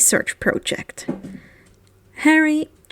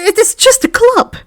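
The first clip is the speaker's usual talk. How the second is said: high, louder flustered protest; squeaky protest; 10 semitones higher, 6 dB louder than usual. Acted. Ron protests to his friends, voice jumping high and loud. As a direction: insist it's just a club, voice jumping up.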